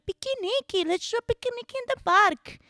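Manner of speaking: put-on high Brazilian accent. Quoting Brazilian roommates in a high Brazilian accent. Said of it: "they were like".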